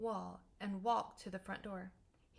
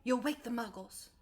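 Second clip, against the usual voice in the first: hissed warning; 4 dB louder than usual. A narrator's reading. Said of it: "hissed Professor McGonagall".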